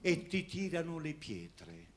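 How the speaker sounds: high, mock-solemn poem with laughter; theatrical swelling poetry reading. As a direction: declaim it grandly, cracking up. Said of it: "volevo recitare una poesia".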